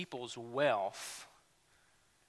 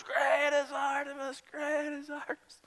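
mock screaming; 10 semitones higher than usual. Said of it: "screamed for two hours".

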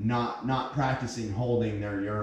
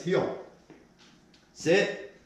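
high, sharp heel command; firm dog commands; 8 semitones higher than usual. Real, not acted. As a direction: snap the order at the dog.

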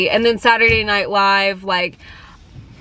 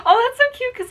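delighted gasp; faster than usual. Real, not acted. Discovers nail polish name.

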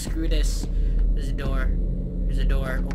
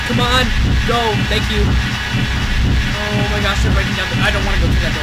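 loud, higher panicked babble; 4 semitones higher, 11 dB louder, faster than usual. Real, not acted. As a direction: panic while fleeing. Fleeing monster, out of stamina.

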